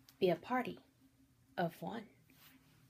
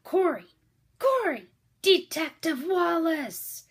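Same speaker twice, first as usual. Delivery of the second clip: high urgent shout across a crowd; yelling; 10 semitones higher, 10 dB louder, slower than usual. A narrator's reading. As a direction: call out his name over the chaos, loud and high. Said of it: "Stephen yelled behind him".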